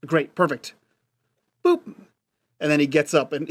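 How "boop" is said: high chirpy boop; phone beep noise. Retold or imitated. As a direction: imitate tapping the phone off. Imitates hanging up the phone.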